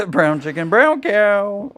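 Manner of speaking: sing-song, last word swooping up high; cheeky lilting jingle through a laugh; teasing catchphrase. Real, not acted. Co-host repeating his innuendo after her slip, sing-song tease.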